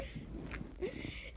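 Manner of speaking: breathless wheezing laugh; silent wheeze laugh. Real, not acted. Reader laughing at own acting, silent wheeze. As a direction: crack up silently.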